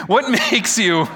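breaks into a laugh mid-question. Real, not acted. Preacher fumbling the line, laughing at himself. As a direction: restart the question and laugh through it. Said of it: "I can't do this again".

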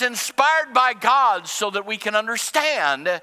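fervent sermon voice; full-force preaching. Acted. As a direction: preach it hard to the congregation.

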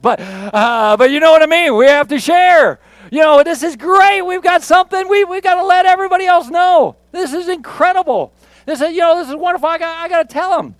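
high, loud, bursting with eagerness; can't wait to tell everyone, overflowing; a mock-giddy enthusiast who must share the news; gushing excitement. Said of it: "that's how Paul was".

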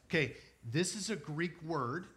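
ordinary delivery

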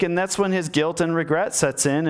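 usual speaking voice

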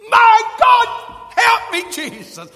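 very high, loud scream, dropping off on the name; panicked cry for help. Acted. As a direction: cry out as if drowning. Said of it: "I think he raised his voice".

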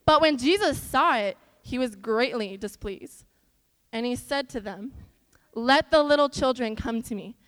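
put-on reading the Bible story aloud to kids; earnest children's Bible reading; scripture reading. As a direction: read it out clearly to a room of children.

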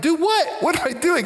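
high-pitched indignant protest; retold exasperated comeback; upset. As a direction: protest as if wrongly accused. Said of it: "but I was upset".

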